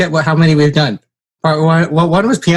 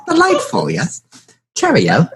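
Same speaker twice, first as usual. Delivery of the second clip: put-on British accent; 3 semitones higher, slower than usual. Continuing mock British bit.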